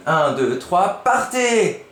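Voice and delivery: high, slow, cheering start; excited go. Shown as a demonstration. Teacher showing an excited exclamation. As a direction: launch it like a race. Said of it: "Il est content".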